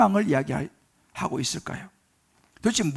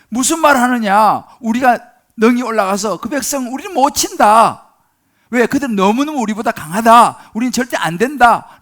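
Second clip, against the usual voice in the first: loud, scornful rebuttal; angry retort; 4 semitones higher, 8 dB louder than usual. Acted. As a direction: shout them down in disbelief. Ten spies angrily retort. (정탐꾼들이 갈렙에게 화내며 크게 반박)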